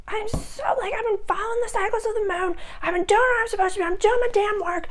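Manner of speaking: high, fast, exasperated whine; hammed-up exhausted rant; frazzled complainer. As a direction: rush it out like a worn-out person begging for a break. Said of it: "and you're like".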